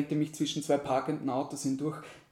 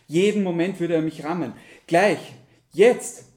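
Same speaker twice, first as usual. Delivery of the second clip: building alarm; 2 semitones higher, 9 dB louder than usual. A narrator's reading. Driver bracing to be rammed at the crossing, mounting alarm. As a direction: build the tension to a loud burst on the last word. Fahrer erwartet den Aufprall.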